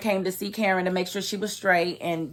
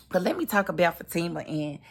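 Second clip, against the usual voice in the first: ordinary talk, nothing marked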